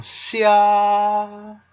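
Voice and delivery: Xia held high and steady. Shown as a demonstration. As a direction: Xia na wysokim, równym tonie. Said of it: "Like that".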